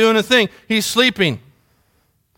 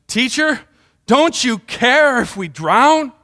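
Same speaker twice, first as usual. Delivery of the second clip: higher, slow, drawn-out appeal; raised-pitch, incredulous question; acted alarmed plea; 4 semitones higher, slower than usual. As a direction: plead with the teacher in dismay. Disciples' panicked plea, acted.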